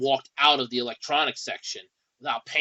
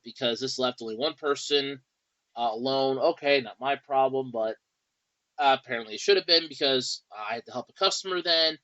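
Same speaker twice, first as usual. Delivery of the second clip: normal talking voice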